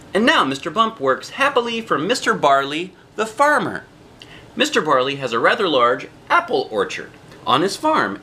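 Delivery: usual speaking voice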